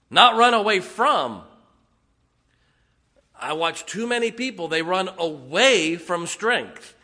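loud, high revival preaching; fiery preaching. Acted. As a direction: preach it with full fire. Preaching at full force.

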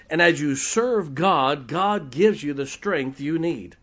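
slow, loud, swelling pulpit preaching; fervent preaching. Acted. Pastor preaching to the congregation about strength, slow and loud. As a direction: lean into it like a revival preacher.

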